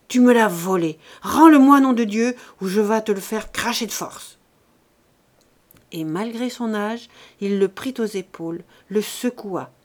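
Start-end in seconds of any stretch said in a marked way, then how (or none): 0.1-4.4 furious accusation. A narrator's reading. Father accusing his son of theft, furious. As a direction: erupt in rage on the demand.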